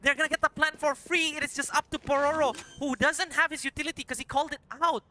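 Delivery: high, loud excited call; esports caster. Acted. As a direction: build the hype for a clutch. Clutch round play-by-play.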